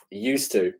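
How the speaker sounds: "Used to." dropped softly to himself; quiet echo. Real, not acted. Quiet echo of his answer.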